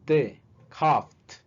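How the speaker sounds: "coughed" with a crisp T at the end; final T sound. Shown as a demonstration. Teacher modelling the T ending. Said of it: "se va a pronunciar como".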